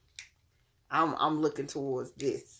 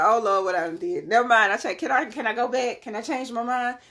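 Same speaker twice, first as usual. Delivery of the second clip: panicky regret; 6 semitones higher, 6 dB louder than usual. Retold or imitated. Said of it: "It's gonna feel like".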